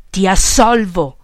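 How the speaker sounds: loud, slow exasperated shout. A narrator's reading. Boss gives in to the stubborn follower, shouted. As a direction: shout it, giving in. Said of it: "gridò Peppone".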